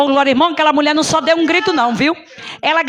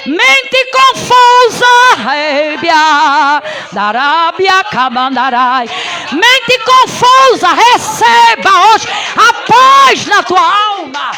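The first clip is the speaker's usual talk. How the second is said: loud, high, slow commanding preaching; preaching at full force; 4 semitones higher, 5 dB louder, much slower than usual. Acted. Preacher commanding peace over the congregation, loud and high. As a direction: command the confusion out with drawn-out cries.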